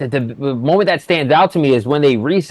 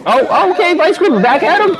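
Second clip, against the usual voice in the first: sudden rise in excitement; excited. Real, not acted. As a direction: caught off guard, voice jumps up. Sudden action in fight.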